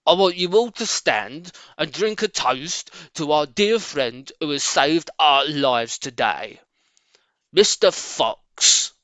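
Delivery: loud, slow Badger voice; Badger calling out. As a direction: proclaim it to the whole feast. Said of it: "called out".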